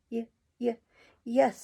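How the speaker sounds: stammered yes, a touch sighed out; sighing, halting yes. A narrator's reading. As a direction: hesitate twice, then let the yes out. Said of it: "sighed out the matron".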